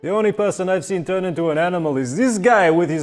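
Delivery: high, loud character voice; excitable innkeeper. Acted. Innkeeper marvelling at a guest turning into a panther, excitable. Game master plays an innkeeper figure.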